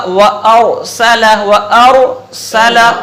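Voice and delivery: slow, drawn-out Quran recitation, each word separate. Shown as a demonstration. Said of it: "अलग-अलग पढ़ रहा हूँ". (अलग-अलग, ठहर-ठहर कर तिलावत)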